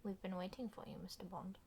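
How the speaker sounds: hushed movie-villain whisper; villainous whisper. Acted. Film villain line whispered.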